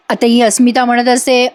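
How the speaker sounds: normal talking voice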